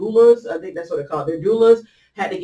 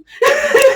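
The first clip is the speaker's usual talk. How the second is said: sudden high burst of laughter; a loud, high laugh out of nowhere; 9 dB louder than usual. Real, not acted. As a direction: crack up at yourself.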